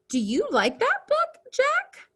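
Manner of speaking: exaggerated storybook question, ending way up on Jack; rising question intonation. Shown as a demonstration. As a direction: voice climbing high on JACK at the end. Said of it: "Our voices raise at the end of a sentence with a question mark".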